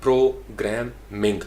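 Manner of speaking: Programming slowly as pro... gram... ming; syllable-by-syllable programming with pauses; three separate syllables. Shown as a demonstration. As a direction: break programming into three beats. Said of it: "तीन syllable हैं".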